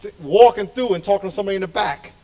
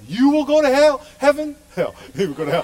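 raised, mock-threatening cult leader voice; mock cult preacher; 6 semitones higher, 4 dB louder than usual. Pastor mocking cult teaching with a hellfire threat. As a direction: ham up the hellfire threat, then laugh.

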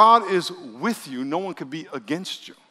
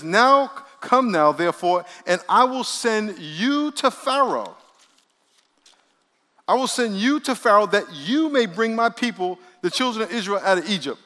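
preaching scripture; 5 semitones higher, 3 dB louder than usual. Acted. Reads God's words in scripture.

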